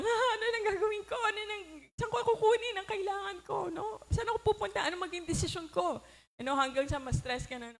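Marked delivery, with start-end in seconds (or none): 0.1-6.0 high, fast panicky rush with a laugh; mock panic. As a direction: play someone frantic, losing it. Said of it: "Magpapanik na lang talaga tayo". (kunwaring taranta, mataas at mabilis)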